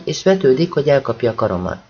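usual speaking voice

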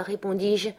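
ordinary delivery

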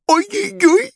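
very high string of yelps; yelping crocodile. Acted. Crocodile hopping uncontrollably, high-pitched yelping. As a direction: yelp helplessly, high and strained.

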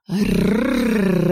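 clearly growled "rrrr" in mild anger. A narrator's reading. King growling at the humming heroine, lightly voiced. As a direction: growl it out through the teeth. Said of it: "отчётливо зарычал король".

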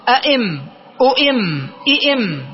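أئم، أؤم، إئم as a'imm, u'imm, i'imm; merged mim. Shown as a demonstration. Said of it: "من أجل الإدغام".

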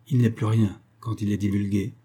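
plain reading voice

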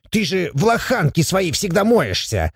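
high-pitched mock outrage; put-on dwarf indignation; indignant dwarf. A narrator's reading. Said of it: "возмутился Абаддон".

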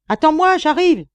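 high, loud call from afar; shouted reply. A narrator's reading. Friend climbing the shaft to join the miner, shouting. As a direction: holler up that you are on your way.

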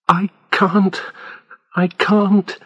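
a frightened patient begging the police to stop; desperate. A narrator's reading. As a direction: near tears but holding on. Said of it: "He wanted to cry".

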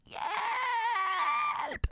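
very high, falling scream of Hjælp!. A narrator's reading. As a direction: shriek it out in panic. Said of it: "skriger musen Ivar".

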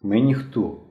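mildly gloomy answer; sullen. A narrator's reading. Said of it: "похмуро відповів Мишко".

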